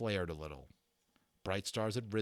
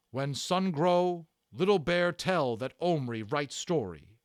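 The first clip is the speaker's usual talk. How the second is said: solemn proud warrior, higher, slow; Little Bear; 5 semitones higher, 7 dB louder than usual. Acted. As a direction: speak as if bearing a strong pain. Little Bear's emotional speech.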